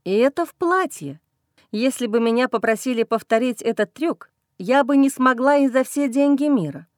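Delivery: ordinary delivery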